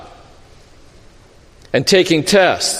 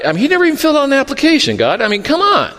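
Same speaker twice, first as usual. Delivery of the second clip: exasperated mock complaint; 6 semitones higher, much faster than usual. Mocking objector complaining to God.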